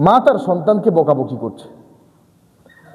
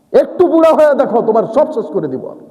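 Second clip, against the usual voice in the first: challenge; 6 semitones higher than usual. Acted. Preacher taunting a young man proud of his strength. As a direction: throw the challenge out hard.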